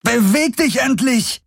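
very high exasperated cry; angry scream. A narrator's reading. Kirai curses at stubborn Uru, screaming. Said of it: "schrie sie".

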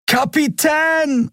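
drawn-out, very high-pitched cry; desperate call. A narrator's reading. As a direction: call out in alarm, stretching the word. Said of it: "rief Miko und sprang". (gedehnter, sehr hoher Schreckensruf)

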